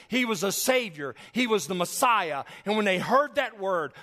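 loud, high-pitched pulpit preaching, pressing each point home; preaching at full force. Acted. Pastor proclaiming to the congregation, loud fervent preaching. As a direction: drive the gospel home from the pulpit.

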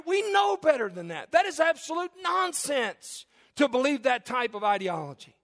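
high, loud fervent preaching; impassioned pulpit preaching; preaching at full force. Acted. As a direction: drive it home to the congregation. Preacher pressing his congregation.

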